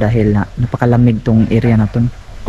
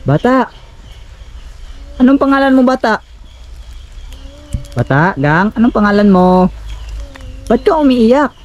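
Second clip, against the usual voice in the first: raised, questioning call; 10 semitones higher than usual. Real, not acted. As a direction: call out across a distance, voice raised high. Calls out to child.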